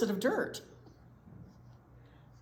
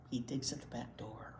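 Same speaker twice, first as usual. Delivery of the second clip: soft hushed whisper; 9 semitones lower, 10 dB quieter than usual. Acted. Whispered to the kids about Roy. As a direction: tell it like a secret at the door. Reading story to children.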